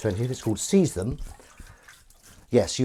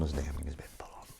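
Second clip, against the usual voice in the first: hushed, whispered aside; very soft, voiceless confiding tone; confidential whisper; 8 semitones lower, 14 dB quieter, much faster than usual. Pretend. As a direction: whisper it behind someone's back. Confidential aside about a fan.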